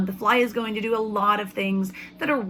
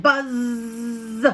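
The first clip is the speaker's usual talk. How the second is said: mad buzz; 2 semitones higher, 4 dB louder than usual. Acted. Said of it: "The fly was mad".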